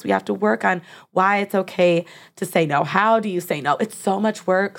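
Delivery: usual speaking voice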